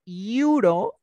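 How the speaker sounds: "euro" as a drawn-out ee-OO-ro, rising; slow, questioning ee-oo-ro for "euro"; vowel-initial euro. Shown as a demonstration. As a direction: stretch "euro" from an ee vowel, loud. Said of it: "mira, I y la U".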